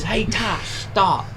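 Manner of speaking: mild imitated yell; retold yell. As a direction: imitate a friend calling out to stop you. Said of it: "so he yells".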